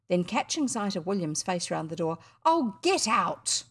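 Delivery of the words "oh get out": slow, drawn-out higher brush-off; teenage brother snapping at his pest of a sibling; annoyed older brother. Acted. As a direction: shoo the kid away with disdain. Brother shoos William away.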